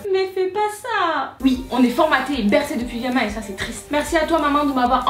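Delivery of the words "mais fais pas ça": put-on child's warning. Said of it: "j'étais en mode".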